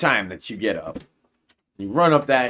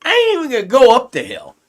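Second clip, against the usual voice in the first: louder and higher, fed-up yell; fed-up outburst; 9 semitones higher, 7 dB louder than usual. Retold or imitated. Quitting the hill fight in the story, louder, fed-up shout. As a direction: blurt it out like you're done with everything. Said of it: "you say".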